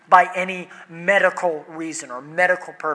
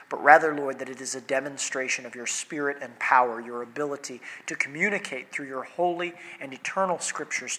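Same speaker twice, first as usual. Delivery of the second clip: softer, reverent prayer voice; 4 semitones lower, 5 dB quieter than usual. Acted. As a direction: pray quietly and humbly to God. Pastor prays before sermon.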